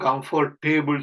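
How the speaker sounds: "கம்ஃபர்டேபிள்" with a full "table" at the end; wrong pronunciation. Shown as a demonstration. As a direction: mispronounce "கம்ஃபர்டேபிள்" as comfor-TAY-bul. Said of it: "அந்த டேபிள்ல உச்சரிப்பு கிடையாது". ("கம்ஃபர்டேபிள்" என்பதில் டேபிள் என நீட்டி)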